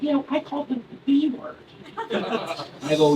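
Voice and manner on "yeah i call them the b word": high first-grader voice; Tommy. Retold or imitated. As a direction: imitate a kid explaining himself. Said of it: "He's like".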